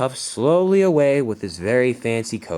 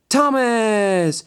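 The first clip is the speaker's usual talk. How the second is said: high, loud, drawn-out name; winner announcement; 11 semitones higher, 5 dB louder than usual. A narrator's reading. Announcer naming the contest winner, high and triumphant. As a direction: proclaim the winner with a big high call.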